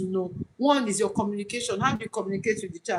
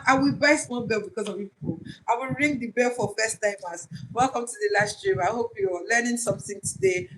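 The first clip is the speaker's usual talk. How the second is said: ordinary talk, nothing marked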